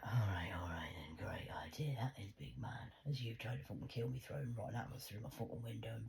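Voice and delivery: whispered, voice dropped low; sarcastic whisper. Retold or imitated. Sarcastic whispered retort to the demonologist. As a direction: sneer it back under your breath.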